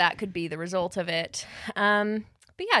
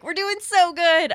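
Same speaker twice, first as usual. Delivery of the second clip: fake cheerful; 8 semitones higher, 6 dB louder than usual. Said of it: "we're like".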